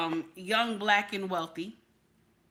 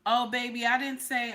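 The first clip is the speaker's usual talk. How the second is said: ordinary talk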